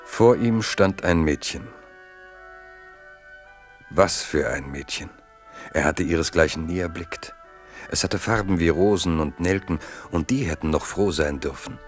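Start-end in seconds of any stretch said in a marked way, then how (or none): none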